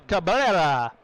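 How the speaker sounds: ordinary delivery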